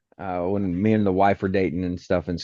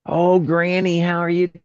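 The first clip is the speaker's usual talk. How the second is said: high mock-sweet teasing voice; a singsong tease; teasing granny greeting; 9 semitones higher than usual. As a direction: greet her sweetly just to needle her. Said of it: "whatever, I'm like".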